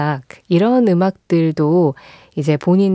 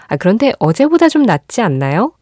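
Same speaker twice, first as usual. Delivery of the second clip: ordinary talk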